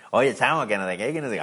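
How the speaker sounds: put-on Venezuelan accent. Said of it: "Y uno".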